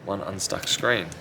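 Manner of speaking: ordinary talk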